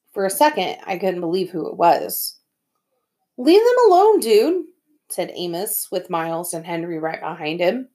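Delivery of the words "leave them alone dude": firm high warning. Acted. Amos confronts the bullies.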